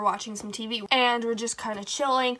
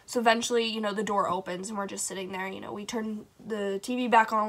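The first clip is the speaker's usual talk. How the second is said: ordinary talk, nothing marked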